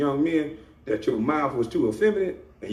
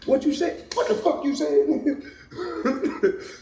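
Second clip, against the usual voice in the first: laughing disbelief; 13 semitones higher, slower than usual. Acted.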